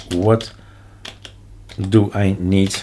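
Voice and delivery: ordinary talk, nothing marked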